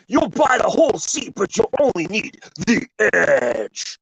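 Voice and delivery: booming high ad announcer; monster truck ad. Pretend. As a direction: hype it like a radio promo. Parodies the monster truck radio ad.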